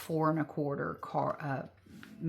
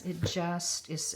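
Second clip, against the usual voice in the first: usual speaking voice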